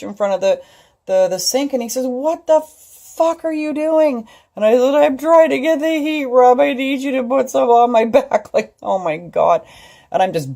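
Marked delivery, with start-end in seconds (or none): 2.1-4.3 high, slow, incredulous question; startled husband. Retold or imitated. Husband finding his wife stuck at the sink, incredulous. Husband finds her crouched. 4.9-8.5 high, loud, pained plea; pleading in pain. Retold or imitated. Wife in pain asking husband Rob for heat, pleading.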